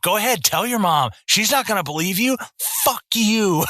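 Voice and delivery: playful retold taunt; mock taunting. Retold taunting of young nephew.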